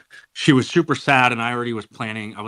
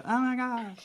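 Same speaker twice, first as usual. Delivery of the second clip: put-on high girly gasp; excited squeal; 3 dB quieter than usual. Second imitation of girlfriends.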